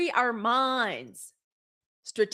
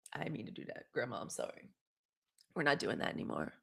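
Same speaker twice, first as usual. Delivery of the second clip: sheepish apology; 11 semitones lower, 11 dB quieter, faster than usual. Pretend. Apologizing to grandma for swearing.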